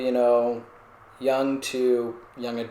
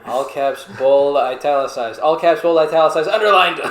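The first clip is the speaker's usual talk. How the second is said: building louder and higher, into laughter; mock escalating shout; 4 semitones higher, 9 dB louder than usual. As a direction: pile it on, each word louder.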